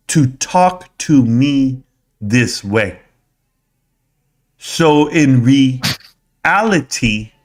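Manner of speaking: very slow, drawn-out, halting delivery; slow impression. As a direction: stretch every word with long pauses. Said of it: "That's how slow he speaks".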